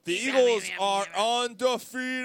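slow booming announcer call; sports announcer. Acted. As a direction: announce it like a stadium PA.

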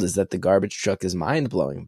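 ordinary delivery

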